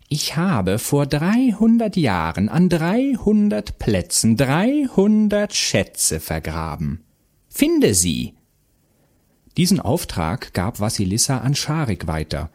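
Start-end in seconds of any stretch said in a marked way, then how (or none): 0.1-8.3 slow, high, swooping witch voice; commanding witch. A narrator's reading. Witch gives Vasilisa her first order, commanding. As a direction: draw out the order like an imperious witch. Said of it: "gab die Hexe ihre ersten Befehle".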